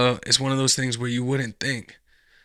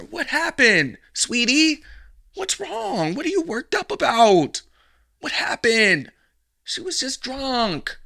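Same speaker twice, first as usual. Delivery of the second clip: high fake-concerned voice; mock fake-sweet man; 10 semitones higher, slower than usual. Mocking the man soothing her, high and syrupy.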